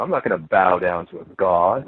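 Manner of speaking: a hint of lofty self-assurance; mock New Age voice. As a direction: lightly parody a proud refusal. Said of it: "you hear people in the New Age".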